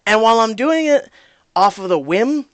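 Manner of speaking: normal talking voice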